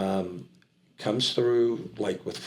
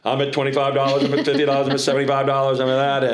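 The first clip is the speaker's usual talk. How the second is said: fast, louder, higher auctioneer patter; auctioneer call; 4 semitones higher, 4 dB louder, faster than usual. Retold or imitated. Practising bids in the shower, fast auctioneer patter. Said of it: "every morning in the shower, I'd go".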